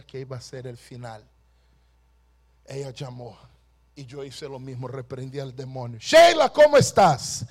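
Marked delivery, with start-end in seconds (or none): 6.0-7.4 high, loud hail; loud ringing call down the phone; shouting her name. Retold or imitated. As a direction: cry out her name in triumph. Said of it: "yo gritaba el nombre de ella".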